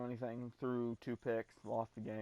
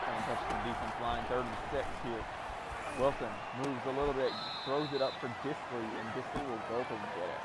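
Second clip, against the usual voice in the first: lively, louder sports call; play-by-play commentary; 4 dB louder than usual. Acted. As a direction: call the action like a broadcaster. Live play-by-play of own game.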